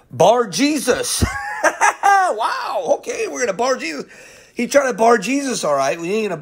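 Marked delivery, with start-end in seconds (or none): 1.3-4.0 fast, laughing through the words. Real, not acted. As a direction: giggle it out quickly, amused. Laughing at the name Bar Jesus.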